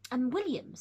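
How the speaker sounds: usual speaking voice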